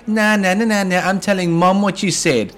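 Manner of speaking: high, laughing playground taunt; childish threat. Retold or imitated. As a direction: taunt like a tattling kid. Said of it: "You know what it reminds me of".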